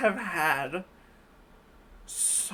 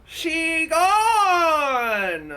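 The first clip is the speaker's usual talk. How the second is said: stunned outcry; 6 semitones higher, 5 dB louder than usual. Real, not acted. Reacting to vanishing figure.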